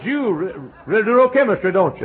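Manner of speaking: high, loud impressed voice; impressed man. Retold or imitated. Chemist praising the preacher's chemistry, high and loud. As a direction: sound amazed at his knowledge.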